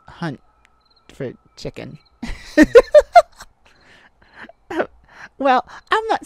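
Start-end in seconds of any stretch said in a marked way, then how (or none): 2.2-3.4 high, loud giggle burst; loud burst of laughter. Acted. Teasing the wolf about hunting, bursting laugh. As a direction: laugh out at your own joke. Laughs at own chicken joke.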